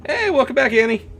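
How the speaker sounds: loud, very high cracking greeting. Real, not acted. Streamer welcoming a viewer back, voice cracking high. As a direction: greet them so brightly the voice cracks. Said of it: "My voice just cracked".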